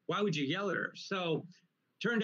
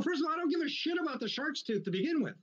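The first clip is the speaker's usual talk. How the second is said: indignant retold rant; 10 semitones higher, faster than usual. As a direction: re-enact an argument in a strained high pitch. Retold quarrel with the ex-wife.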